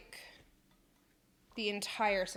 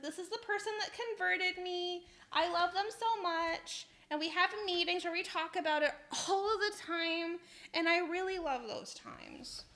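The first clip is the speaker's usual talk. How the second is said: lightly mocked higher-pitched professor voice; imitating gushing professor; 8 semitones higher than usual. Student retells professor confiding in them, mock gushing. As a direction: play an earnest convert gushing, pitched up.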